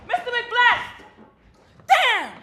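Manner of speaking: high loud startled call; startled cry. Acted. Therapist startled by Mister McBlast, high startled cry. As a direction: jolt up in alarm.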